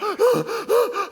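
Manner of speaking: high loud sobbing gasp; a hyperventilating crying gasp. Retold or imitated. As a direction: gulp air as if crying. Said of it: "hyperventilating kind of cry".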